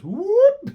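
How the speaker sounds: a very high, loud whoop. Retold or imitated. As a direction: let out a sudden playful whoop. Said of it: "Bianca Belair just goes".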